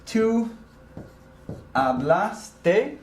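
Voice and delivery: normal talking voice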